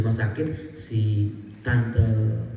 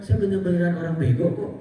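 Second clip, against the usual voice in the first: normal talking voice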